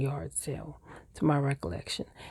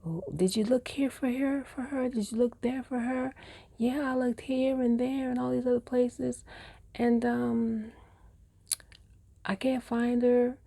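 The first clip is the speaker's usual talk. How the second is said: worried parents; 6 semitones higher than usual. Retold or imitated. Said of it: "the worry in my mother's voice".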